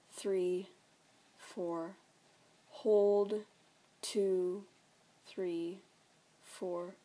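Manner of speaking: counted breathing. Real, not acted.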